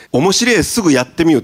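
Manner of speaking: company slogan in Okayama dialect. Shown as a demonstration. President presenting the company slogan to investors, Okayama dialect. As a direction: say it with a local Okayama lilt. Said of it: "これ岡山弁でございます".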